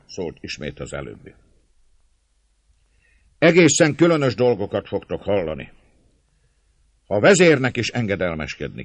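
magas, hangos szónoki hang; szólj derűsen az egész tömeghez; jókedvű népszónok. A narrator's reading. (tréfás népszónokként, emelt hangon)

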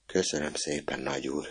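put-on boy thanking Nagy úr, near tears; tearful thanks. A narrator's reading. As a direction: thank him almost in tears. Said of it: "tele lett a szeme könnyel".

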